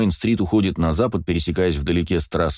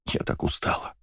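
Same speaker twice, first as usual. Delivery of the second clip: tired breathy whisper; 8 dB quieter than usual. Acted. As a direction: whisper it, worn out. Crying sister, unvoiced.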